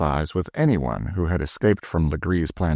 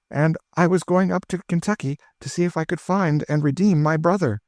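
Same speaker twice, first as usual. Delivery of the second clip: high-voiced lady telling her past; a widow recounting her story with feeling; lightly voiced Madame de Thou; French lady; 10 semitones higher than usual. A narrator's reading. Madame de Thou tells her history.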